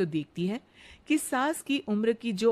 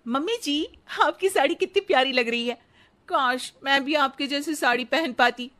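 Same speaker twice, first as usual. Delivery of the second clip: put-on higher, eager young bride's voice; sweet daughter-in-law; 5 semitones higher, 5 dB louder than usual. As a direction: flatter the mother-in-law sweetly. Radha the daughter-in-law speaks.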